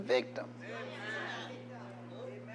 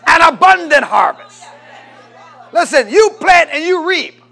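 much higher and louder, building to a shout; preaching at full force; 5 semitones higher, 14 dB louder than usual. Real, not acted. Preacher declaring victory to the crowd, shouting. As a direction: whip the congregation up to a roar.

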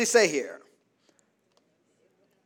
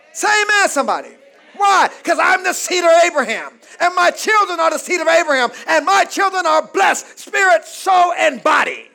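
loud, high preaching shout; rousing pulpit shout; fiery preaching; 6 semitones higher, 7 dB louder than usual. Real, not acted. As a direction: preach it full force to the congregation. Preacher at full force.

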